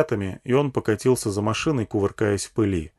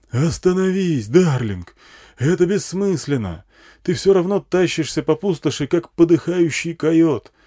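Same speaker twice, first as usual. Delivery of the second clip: amplified pleading, pitched up; megaphone yelling; 7 semitones higher, 3 dB louder than usual. A narrator's reading. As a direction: reason with a runaway lover by loudhailer. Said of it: "орал мегафон на всю пустыню".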